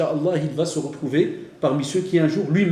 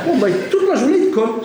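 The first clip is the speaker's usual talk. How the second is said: laughing; 11 semitones higher, 5 dB louder than usual. Real, not acted. Élèves insistants, ton amusé.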